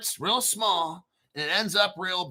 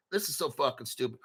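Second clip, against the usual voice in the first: fed-up muttering; 6 semitones lower, 8 dB quieter, much faster than usual. Real, not acted. Fed up reading jargon aloud.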